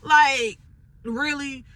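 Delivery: incredulous shouted outrage; outraged disbelief. Retold or imitated. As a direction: explode in disbelief at wasted work. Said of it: "it's like".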